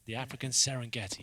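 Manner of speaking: nature documentary voice. Pretend. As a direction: narrate it like a wildlife film. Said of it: "nature documentary voice".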